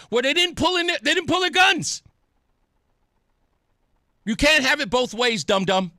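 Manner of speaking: loud, heated scolding; exasperated rebuttal. Real, not acted. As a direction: argue back hotly at the tape. Host rebutting the played clip angrily.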